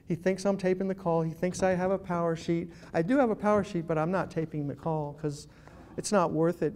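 ordinary talk, nothing marked

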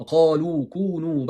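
kalu kûnü, gırtlaktan kalın kaf ile, uzatarak; KALU'yu kalın k ve uzun hecelerle oku; doğru Arapça okuyuş. Shown as a demonstration. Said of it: "Bakın bunlar Arapça gibi". (Arapça kaf'lı, ağır ağır kalu)

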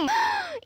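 high gasp of inspiration; sudden gasp. Acted. A child suddenly gets a game idea, excited gasp.

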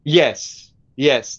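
ordinary talk

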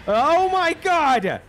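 shocked, high, drawn-out cry; loud exclaim. Real, not acted. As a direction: blurt it out in alarm. Shock at game event.

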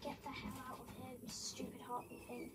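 scared hushed whisper; whispering while hiding. Real, not acted. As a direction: whisper so nothing hears you.

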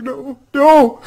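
put-on high-pitched frightened kid refusing; frightened child. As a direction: squeal it like a scared child hiding. Scared kids refuse.

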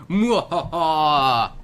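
slow evil cackle; hammy villain mwahaha; mad scientist laugh. Pretend. Villain laugh after combo.

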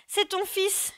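trembling voice. A narrator's reading. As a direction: plead for your son, voice trembling. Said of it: "lui dit-elle d'une voix tremblante". (voix tremblante de mère suppliante)

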